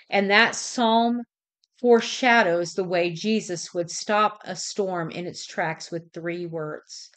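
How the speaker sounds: ordinary delivery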